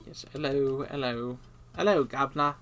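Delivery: hammed-up British greeting; fake British accent. Player greeting a "governor" while fishing, fake British accent. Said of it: "a convincing British accent sounds like".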